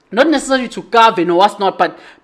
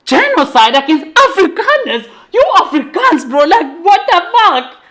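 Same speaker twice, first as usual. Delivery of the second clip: higher, laughing mock outrage; laughing through a friend's baffled retort; laughing disbelief; 6 semitones higher than usual. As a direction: play the friend cracking up in disbelief. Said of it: "And the guys like".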